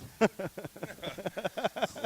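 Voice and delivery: high, rippling run of chuckles; knowing laughter. Real, not acted. Guest asked about new products, knowing laughter. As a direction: laugh before dodging the question.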